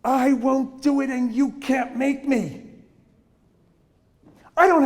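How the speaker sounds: put-on sing-song defiance of a sassy kid; mock defiant kid; defiant child. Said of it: "very disrespectful".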